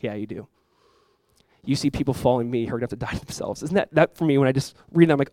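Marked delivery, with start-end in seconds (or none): 0.0-3.6 soft Jesus voice. Pretend. Voicing Jesus answering softly.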